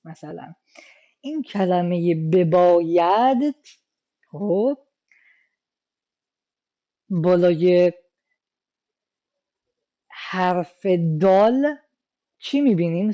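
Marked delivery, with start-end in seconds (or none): none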